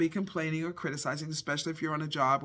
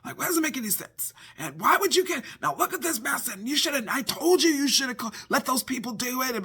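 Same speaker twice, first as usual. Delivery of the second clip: nagging rant; 7 semitones higher than usual. Retold or imitated. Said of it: "I would have been like".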